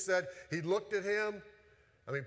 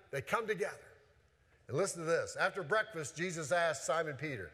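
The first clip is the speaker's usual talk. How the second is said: ordinary talk, nothing marked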